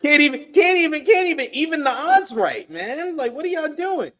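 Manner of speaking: high-pitched comic complaint; mock exasperation. Co-host griping about uneven odds, high mock exasperation. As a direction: whine it in a high put-on voice.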